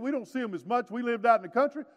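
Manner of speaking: usual speaking voice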